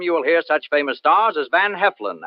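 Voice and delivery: ordinary talk, nothing marked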